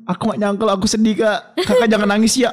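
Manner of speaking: high-pitched mock fan pleading; imitating sympathetic fans. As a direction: gush consolation like a worried follower. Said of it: "isi D M tuh".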